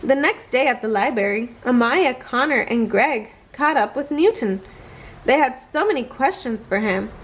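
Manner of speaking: plain reading voice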